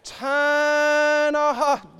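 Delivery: slow and rising, ending in a full shout; building to a shout. Real, not acted. Preacher to the congregation, slow rising call ending in a shout. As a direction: build slowly then cry out to the Lord.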